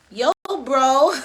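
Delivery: loud, high American "bro" greeting; American accent. Shown as a demonstration. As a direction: put on a big, hyped American accent. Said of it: "Watch my American".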